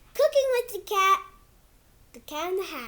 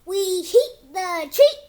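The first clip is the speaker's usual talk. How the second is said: put-on reading to kids; 3 dB louder than usual. Kid reading his book to viewers in storybook voice. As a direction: read it like a bedtime book for little ones. Reads picture book aloud.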